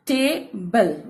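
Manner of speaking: "टेबल" split into two syllables, long A first; syllable break. Shown as a demonstration. Teacher shows children the break in "टेबल", syllable by syllable. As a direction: say "टेबल" in two parts, stretching the टे. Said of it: "यहाँ पे देखिए break हो रहा है".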